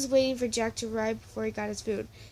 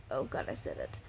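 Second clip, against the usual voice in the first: embarrassed whisper; 9 dB quieter than usual. Real, not acted. Reader's embarrassed aside at swear.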